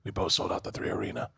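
hushed whisper, retelling a confidence; close-up whisper. Retold or imitated. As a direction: lean in and whisper it in awe. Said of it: "he gets in close".

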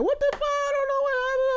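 high mock-hysterical wail, imitating the crying lady; shrill put-on shriek of the bleeding wife; imitating a screaming lady. Telling his friends about the screaming wife, mock high wail. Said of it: "she just yelling, crying".

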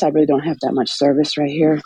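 normal talking voice

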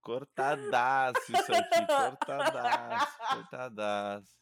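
slow words through laughter; laughing. Real, not acted. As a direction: laugh while dragging it out. Laughing at the choppy audio. (rindo devagar)

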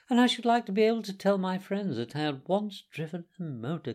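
high-pitched, eager pleading; polite, coaxing Toad. Acted. Toad asking the driver to let him drive, polite and coaxing. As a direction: coax sweetly in Toad's high voice.

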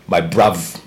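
put-on English accent. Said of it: "what accent was that".

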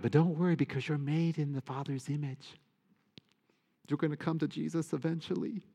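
high, softening sing-song comfort; mock reassurance. Priest privately reassuring other believers in his head, mock reassurance. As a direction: soothe them playfully in a high voice. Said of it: "But in my mind, I'm like".

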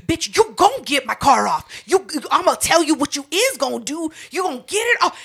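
high, loud menacing yell; imitated threat. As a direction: threaten the tow driver loudly. Neighbor threatening.

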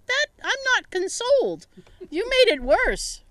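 high-pitched mock complaint; retold indignation. Retells not being consoled.

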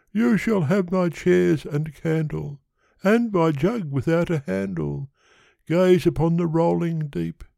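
high-pitched pleading suitor; Yonghy Bonghy Bò. Acted. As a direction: propose in a high character voice.

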